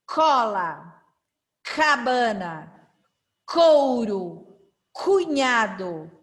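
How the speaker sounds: each word from "cola" to "camelo" slow, with a hard "k" start; emphasised initial K. Shown as a demonstration. Teacher showing the difference in sound, emphatic "k". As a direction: separate words, firm "k" and clear falling end. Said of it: "perceber a diferença do som".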